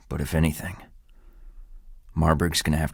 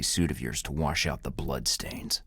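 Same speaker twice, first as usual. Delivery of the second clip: menacing whisper; 4 semitones lower, 6 dB quieter than usual. Acted. Threat trailing into whisper.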